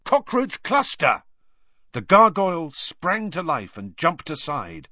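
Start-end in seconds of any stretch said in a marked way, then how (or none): 0.0-1.2 very high exclamation; angry yell. A narrator's reading. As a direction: yell a last exasperated guess. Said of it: "he yelled angrily".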